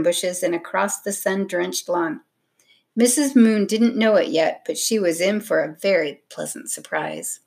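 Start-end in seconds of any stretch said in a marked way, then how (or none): none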